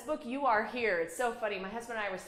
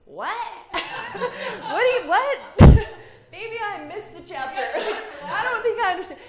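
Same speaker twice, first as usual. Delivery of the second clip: much higher, laughing mock-bewildered husband; laughing high imitation of her husband not getting it; husband's confused voice; 12 semitones higher, 16 dB louder than usual. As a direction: play the puzzled husband through laughter. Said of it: "And he's like".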